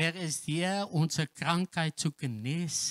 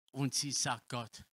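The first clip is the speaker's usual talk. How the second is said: ordinary talk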